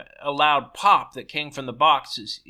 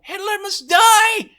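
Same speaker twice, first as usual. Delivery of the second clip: high, loud, slow furious yell; slow screamed fury; raging shout; 12 dB louder, slower than usual. A narrator's reading. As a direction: bellow it slowly in pure rage. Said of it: "After a few moments of rage".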